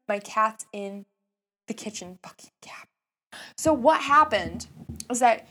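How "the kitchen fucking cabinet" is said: quick, hushed whisper of disbelief; incredulous whispered reveal; stunned whisper. Real, not acted. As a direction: whisper the swear for dramatic effect.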